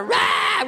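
high, loud hammed-up roar; mock yell. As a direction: let out a silly yell. Son yells at daughter.

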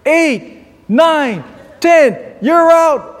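high, loud, drawn-out knockout count; mock referee shouting, building to the last call; boxing referee's count. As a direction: count him out like a ringside referee. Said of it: "the referee's going".

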